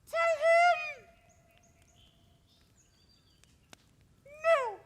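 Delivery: high, haughty princess refusal; cartoon princess. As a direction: toss it off like a spoiled princess.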